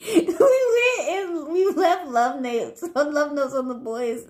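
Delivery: high, slow words broken by laughter; helpless laughter as the prank is told; laughing through it. Real, not acted. Laughing while retelling prank.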